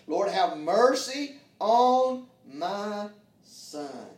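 loud, very slow, drawn-out plea; slow pleading cry. Acted. Father begging Jesus for his son, slow loud cry. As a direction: stretch every word of the plea out.

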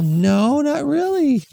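put-on high, loud protesting kid; child voice. Kid protesting to mom, high voice.

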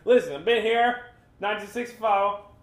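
put-on laughing at the stubborn local in a North Carolina accent; teasing the holdout resident in a mock North Carolina accent. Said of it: "That's my North Carolina accent".

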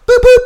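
high beeping horn sound; car horn beeps. Retold or imitated. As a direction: beep like a horn.

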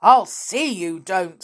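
loud, understated anger; stern telling-off from a father; angry father. A narrator's reading. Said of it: "said Len's father angrily".

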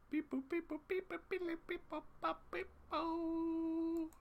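very high, soft electronic beeps; mimicked dialing beeps. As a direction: imitate phone beeps in a tiny high voice. Mimes placing call to Kermit.

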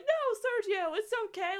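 high-pitched imitation of clueless comforting; mocking high voice, soothing words; mock reassurance. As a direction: coo it like a fooled character. Mocks fooled characters comforting Sergio.

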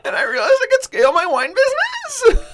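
high, loud, over-the-top hype shout; hyped-up Gary V impression. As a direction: scream it like an ecstatic hype guru. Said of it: "the Gary V".

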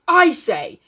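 loud, blazing retort; angry outburst. A narrator's reading. Ralph losing his temper with Jack, loud. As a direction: snap it back in anger. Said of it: "Ralph's temper blazed out".

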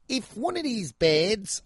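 put-on high, slow, failed Aussie accent; bad Australian accent. Imitating girls on the show, in a high, bad Australian accent. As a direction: attempt a high-pitched Australian accent and botch it. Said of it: "I can't do an Australian accent".

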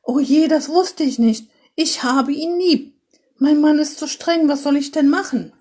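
hoch, laut und verzweifelt klagend; schluchzend. Acted. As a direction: gespielt schluchzende Mutter, höher und lauter. (die Mutter klagt dem Weihnachtsmann schluchzend ihr Leid)

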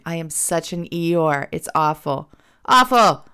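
ordinary delivery

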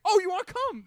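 high-pitched, delighted retold question; high, eager surprise; imitated pleasant surprise. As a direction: act out being happily taken aback. Retells Lynch's delighted surprised reply.

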